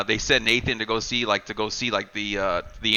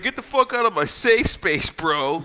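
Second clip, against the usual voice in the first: retold angry yell; 7 semitones higher than usual. Evan cornered, yelling at zombie.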